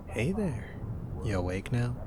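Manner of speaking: ordinary talk, nothing marked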